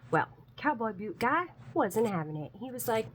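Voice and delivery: normal talking voice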